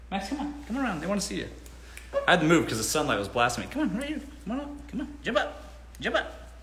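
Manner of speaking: high, soft coaxing call to a dog. Real, not acted. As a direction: coax the dog up sweetly. Calling the dog up.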